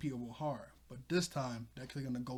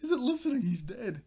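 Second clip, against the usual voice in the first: laughing; 7 semitones higher, 6 dB louder than usual. Real, not acted. Giggling about the limp body.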